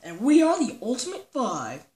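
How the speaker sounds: ordinary delivery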